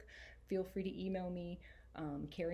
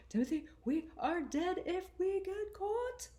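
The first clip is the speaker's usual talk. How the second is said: friend's voice; 13 semitones higher, 6 dB louder than usual. Acted. Friend Jose's worried whisper quoted.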